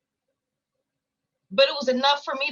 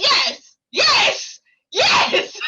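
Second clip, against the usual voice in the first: much higher, loud overjoyed yelling; ecstatic yes; 10 semitones higher, 7 dB louder, much slower than usual. Retold or imitated. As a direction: shout yes again and again for joy. Accepting the settlement offer.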